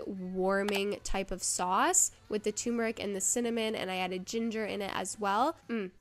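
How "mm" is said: usual speaking voice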